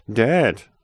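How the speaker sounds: loud, high reproachful cry; indignant daughter's protest. A narrator's reading. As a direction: scold your father in one loud word. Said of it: "schalt die vorwurfsvolle Stimme".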